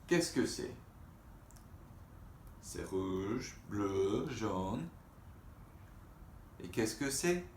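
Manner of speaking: put-on questions up, answers settled, to kids; reading to children. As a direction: ask and answer like a picture book. Reading French picture book aloud.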